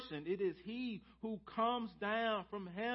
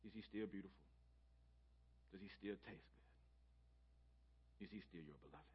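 much softer and lower, near whisper; soft intimate questions; 11 semitones lower, 21 dB quieter, much faster than usual. Real, not acted. Preacher tenderly asking believers, soft and low. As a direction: ask tenderly, as if to a friend.

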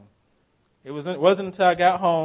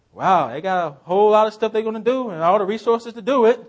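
amazed; 7 semitones higher, 9 dB louder than usual. Retold or imitated. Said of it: "I'm like".

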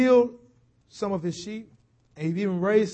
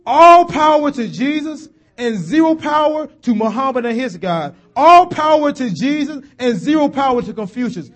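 loud, high, driving declarations; rising preacher proclamation; fervent preaching; 5 semitones higher, 9 dB louder than usual. Acted. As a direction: hammer each line home. Preacher building to a shout.

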